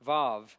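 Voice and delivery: "Vav" with a v at both ends, not w; letter name vav. Shown as a demonstration. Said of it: "but it's vav".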